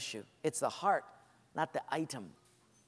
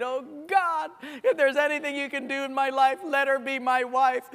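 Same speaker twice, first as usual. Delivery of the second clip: higher, earnest mock pleading; teen's plea; 6 semitones higher, 4 dB louder than usual. Teenage preacher begging God for the cheerleader, mock earnest. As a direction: beg God like a lovesick teenager. Teen boy's pleading prayer.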